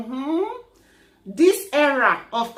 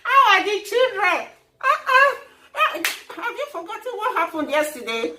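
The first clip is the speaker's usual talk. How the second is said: overloud chatty neighbour; 8 semitones higher than usual. Acted. Overloud lift chatter with laughs.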